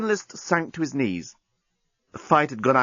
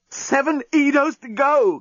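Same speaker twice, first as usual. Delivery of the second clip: much higher, slow panicked gasp; 12 semitones higher, slower than usual. Acted. The journalist to Lucy at the empty lifeboat station, gasping in panic. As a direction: gasp it out in alarm. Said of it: "gasped the journalist".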